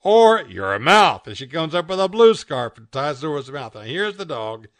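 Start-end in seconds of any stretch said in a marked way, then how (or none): 0.0-1.3 loud, high, slow and stretched; emphatic topper. A narrator's reading. Barbara covers Marmaduke's mouth, emphatic. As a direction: land each word as the last item.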